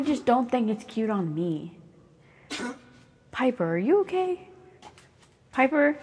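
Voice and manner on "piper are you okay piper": high calling voice for a pet. Real, not acted. Owner calling out to her pet Piper, high pitched. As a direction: call the pet, higher and coaxing.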